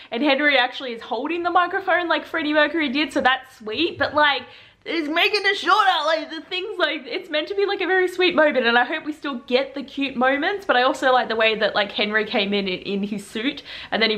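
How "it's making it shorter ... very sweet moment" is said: whiny complaint. Real, not acted. Complaining about changes.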